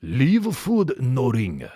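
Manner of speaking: hammed-up Japanese accent, vowels tacked on; mock Japanese accent. Repeating his note for laughs, mock Japanese accent. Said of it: "like a fucking Japanese guy trying to learn English".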